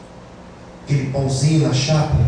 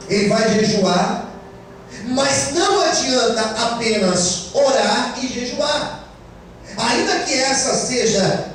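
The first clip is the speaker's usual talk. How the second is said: raised, fervent preacher voice; preaching; 8 semitones higher, slower than usual. Acted. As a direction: preach it out to the church. Sermon preaching, raised register. (pregação fervorosa)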